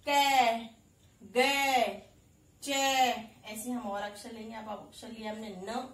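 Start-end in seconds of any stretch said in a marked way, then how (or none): 0.1-2.7 कै गै चै each called loud with a clear ai; teaching the sound. Shown as a demonstration. Teacher reviewing ऐ syllables for children, loud. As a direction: tick through कै, गै, चै like flashcards. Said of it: "देखिए".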